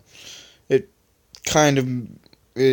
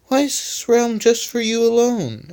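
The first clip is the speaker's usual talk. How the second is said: Rubber's voice; 10 semitones higher than usual. Acted.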